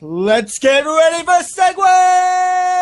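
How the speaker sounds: announcer shout. Acted. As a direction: belt it like a ring announcer. Hyping up the show opening.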